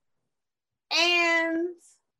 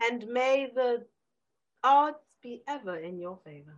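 Hunger Games quote; 3 dB quieter than usual. Pretend. Quoting The Hunger Games to her friend, lightly solemn. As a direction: intone it like the film. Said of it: "Katniss Aberdeen".

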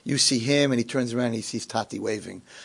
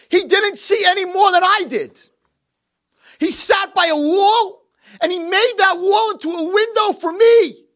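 tearful impassioned plea; 6 dB louder than usual. Acted. Eulogy for his friend.

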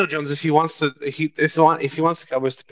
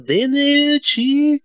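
hammed-up Italian accent, much higher, drawn out slow; poor Italian accent; 12 semitones higher, 4 dB louder, slower than usual. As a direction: stretch DiNucci like a bad Italian caricature. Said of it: "but it's a very poor Italian one".

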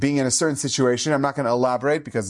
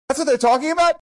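put-on much higher, a child's relieved disbelief; relieved kid; 12 semitones higher, 4 dB louder, faster than usual. Boy hears it's only under his hair: high relieved surprise. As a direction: sound like a little boy let off the hook.

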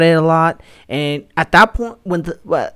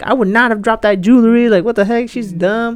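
mocking viewer; 6 semitones higher, 4 dB louder than usual.

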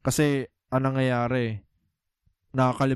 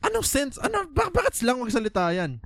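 incredulous; 12 semitones higher than usual. Real, not acted. Ranting about actors faking slang.

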